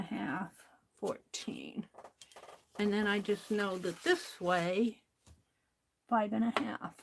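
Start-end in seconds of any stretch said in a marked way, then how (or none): 0.0-1.9 a soft whispered number. Real, not acted. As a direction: breathe the word out under your breath. Counting marks, drops to whisper.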